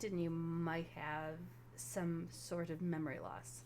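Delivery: low-pitched, careful explanation; Artemis. Acted. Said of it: "Artemis just looks and says".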